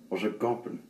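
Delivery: ordinary delivery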